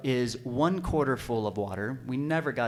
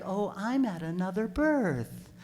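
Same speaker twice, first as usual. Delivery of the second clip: slow, higher-pitched mock breezy midwife; unhurried midwife; 6 semitones higher, slower than usual. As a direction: draw it out lightly, imitating the midwife.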